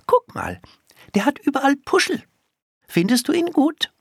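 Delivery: put-on high, eager child's voice; small girl showing off her pet, pitched right up; little girl. A narrator's reading. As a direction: ask for approval in a high girlish voice. Girl seeks approval for her pet.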